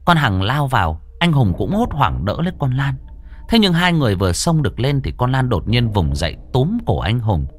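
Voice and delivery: tense, lively storytelling voice; horror story narration. A narrator's reading. Narrator telling a ghost story to listeners, tense storytelling. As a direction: pull listeners into the haunted boarding house.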